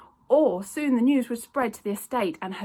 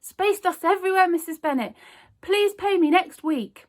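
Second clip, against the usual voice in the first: flustered shopkeeper; 10 semitones higher, 8 dB louder than usual. A narrator's reading. Raj flustered lie.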